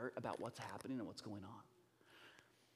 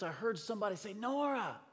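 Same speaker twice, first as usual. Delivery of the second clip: lightly voiced child, higher pitch; insistent daughter; 8 semitones higher than usual. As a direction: retell a child insisting to dad. Acts out daughter's insistent report.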